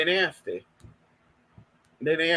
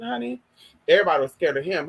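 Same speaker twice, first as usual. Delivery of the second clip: usual speaking voice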